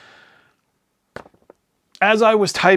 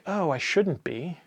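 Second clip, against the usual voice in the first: mock guilty aside; 7 dB quieter than usual. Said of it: "But then I think".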